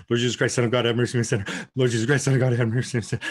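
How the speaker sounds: rapid, run-together repeated prayer; panicked praying. Shown as a demonstration. Woman showing her prayer in a panic attack, rushed. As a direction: rattle the prayer off without a breath. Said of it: "in panic attack, it's more like".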